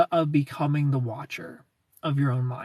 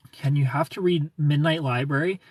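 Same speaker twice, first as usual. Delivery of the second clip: ordinary delivery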